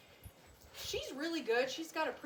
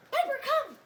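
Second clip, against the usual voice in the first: calling the dog; 10 semitones higher, 11 dB louder than usual. Real, not acted. Trainer calls dog.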